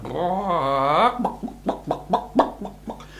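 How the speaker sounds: high clucking chicken noises; hen clucking. A narrator's reading.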